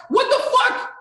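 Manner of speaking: high, loud disbelieving yell; outraged shout. Real, not acted. Ranting at the woman about the blue box, outraged shout. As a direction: blast her in disbelief.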